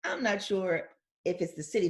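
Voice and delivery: ordinary delivery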